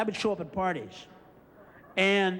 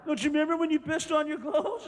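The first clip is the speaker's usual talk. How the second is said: high mocking friends' voice; teasing friends; 7 semitones higher than usual. Friends teasing him about the night, high mocking voice. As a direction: rib him, high and singsong. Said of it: "They go".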